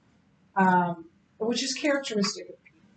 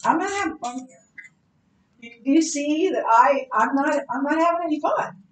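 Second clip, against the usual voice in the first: mock complaint; 5 semitones higher, 6 dB louder than usual. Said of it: "And I'll say".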